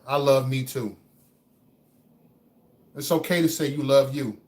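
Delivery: usual speaking voice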